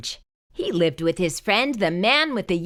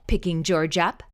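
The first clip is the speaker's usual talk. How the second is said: ordinary delivery, nothing marked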